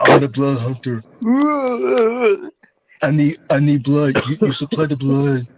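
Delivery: lightly groaned zombie wrestler promo; a hint of undead wrestler menace. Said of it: "I'm working my gimmick".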